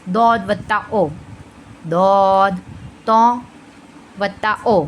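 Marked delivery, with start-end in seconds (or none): 1.9-2.6 "दौद" drawn out with a rounded "o"; long, full "दौद" coloured with "o"; heavy "o" sound. Shown as a demonstration. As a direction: fill the mouth on "दौद". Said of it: "ओ की sound आते हुए हम अदा करेंगे".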